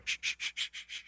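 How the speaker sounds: quick run of soft, voiceless shushes; imitated shushing. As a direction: shush repeatedly under your breath. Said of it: "and Steve going".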